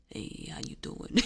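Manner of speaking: playful imitation of a deep flirty voice; sexy deep voice. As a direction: purr it like a smooth charmer. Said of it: "with this really sexy deep voice".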